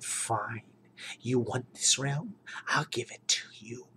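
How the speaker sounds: Baba Yaga hissing a cursed bargain; menacing whisper. Acted. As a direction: witch granting the wish to the warlord in a whisper. Baba Yaga grants wish menacingly.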